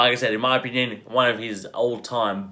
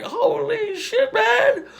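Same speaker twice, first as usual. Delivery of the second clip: very high, drawn-out laughing squeal; mock amazed reaction; much slower than usual. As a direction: squeal it in giddy disbelief. Mock reaction to swearing in movie.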